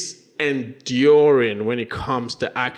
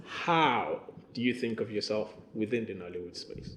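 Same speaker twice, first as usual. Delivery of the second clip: softer, gentler rephrasing; humble; 4 semitones lower, 9 dB quieter than usual. Shown as a demonstration. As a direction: ask it more humbly, quietly. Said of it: "that sounds a little more humble".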